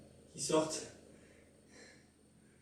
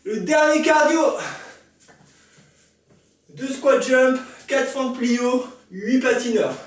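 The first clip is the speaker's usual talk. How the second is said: calling out; 6 semitones higher, 7 dB louder, slower than usual. Real, not acted. Coach calling out workout.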